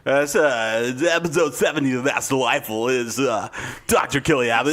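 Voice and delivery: halting show intro in an uncertain put-on voice; self-conscious stab at a podcast opening; embarrassed, unsure announcer. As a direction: feel around for the announcer inflection. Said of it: "he's a little embarrassed because he's not exactly sure how".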